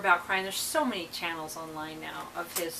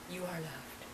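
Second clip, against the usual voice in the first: low-key, much softer mock blessing; mocking imitation; 3 semitones lower, 14 dB quieter than usual. Girlfriend and her people making fun, hushed mock solemnity. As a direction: quietly parody the solemn motto. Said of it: "would make fun of me".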